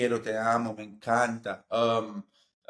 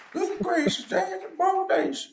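put-on voice; slower than usual. Exclaiming in a put-on voice.

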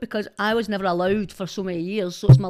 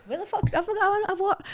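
high laughing voice, words bubbling through giggles; cracking up mid-sentence, pitch way up; 12 semitones higher, 3 dB quieter than usual. Real, not acted. Guest cracks up at the host's odd phrase, laughing.

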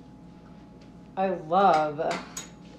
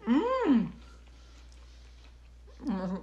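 mouth full; 6 semitones higher, 3 dB quieter than usual. Real, not acted. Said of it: "My mouth is full".

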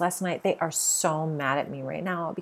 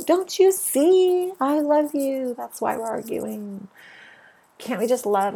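pleading shout; 7 semitones higher, 5 dB louder than usual. Pretend. Shaking people who won't understand, loud pleading. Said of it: "wanna shake them and go".

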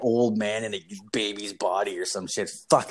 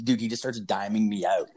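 ordinary talk

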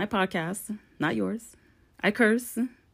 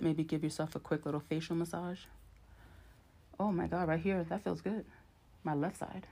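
quiet; 11 dB quieter than usual. Real, not acted. Massaging her own face.

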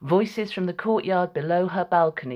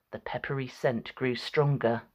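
usual speaking voice

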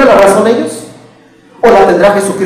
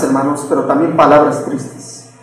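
ordinary delivery

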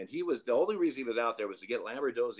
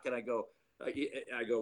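ordinary talk

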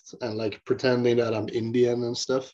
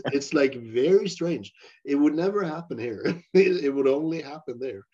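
ordinary delivery

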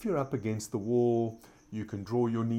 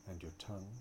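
soft spa whisper; 10 dB quieter than usual. Acted. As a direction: ease them into stillness, growing softer. Guided relaxation in corpse pose.

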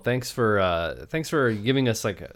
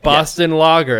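put-on louder, playful Boston accent on the label; 7 semitones higher, 11 dB louder than usual. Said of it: "with a Boston accent".

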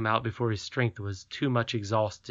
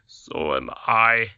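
slow, gruff, meek agreement; a hint of a tired king; lightly voiced king; 5 dB louder, slower than usual. A narrator's reading. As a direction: goblin king following to bed.

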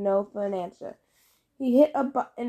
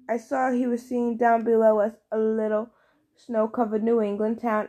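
ordinary delivery

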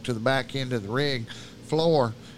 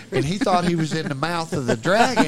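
plain reading voice

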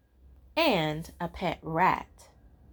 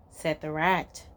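ordinary delivery

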